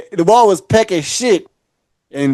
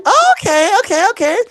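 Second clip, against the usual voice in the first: high-pitched imitation of her reaction; mock surprised girl; 12 semitones higher than usual. Man retelling his chat with a girl, high mock surprise. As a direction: let the okays leap up high, imitating her.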